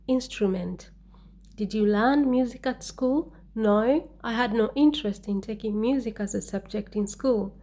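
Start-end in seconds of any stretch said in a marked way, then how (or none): none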